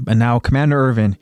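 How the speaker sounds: plain reading voice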